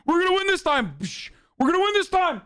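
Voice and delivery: high smug mock chant, stress on this; mocking Trump supporters. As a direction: sneer it high and cocky, over and over. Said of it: "And they're like".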